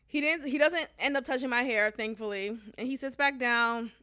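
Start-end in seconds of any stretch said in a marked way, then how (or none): none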